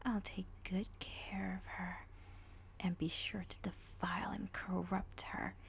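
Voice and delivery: slow, sly whisper of a dark mare; creepy villain voice. Acted. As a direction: purr the greeting like a lurking villain. Villain addresses audience.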